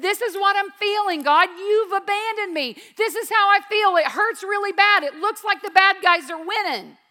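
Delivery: voicing David's hurt at full force; anguished complaint. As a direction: raw lament to God.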